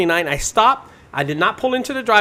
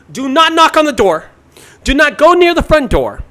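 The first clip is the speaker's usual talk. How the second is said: emphatic loud warning; 8 semitones higher, 8 dB louder than usual. Retold or imitated. Relaying customer's strict instructions.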